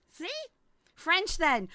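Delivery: usual speaking voice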